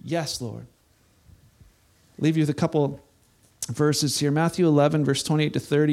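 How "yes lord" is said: soft, hushed yes to God; quiet surrender. Pretend. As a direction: say it low, giving in. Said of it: "to say".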